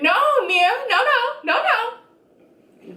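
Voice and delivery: high, insistent mock interruption; high-pitched retold objection. She stops Mia mid-talk, high insistent objection. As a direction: cut in with rising, high no-nos. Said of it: "She goes".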